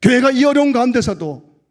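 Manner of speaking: very high, shouted sermon proclamation; loud impassioned pulpit shout; full-force preaching. Real, not acted. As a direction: build the cry to rally the congregation.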